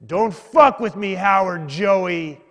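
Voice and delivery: loud, slow mock menace; imitating a biker's drawn-out threat. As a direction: warn them off like an angry street biker. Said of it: "And he goes".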